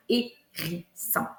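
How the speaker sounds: "hérisson" in three separate slow beats; split into syllables. Shown as a demonstration. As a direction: pause between é, ri and sson in "hérisson". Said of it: "j'ai trois syllabes".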